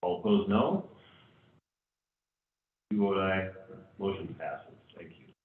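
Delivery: ordinary delivery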